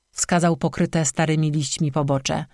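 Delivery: plain reading voice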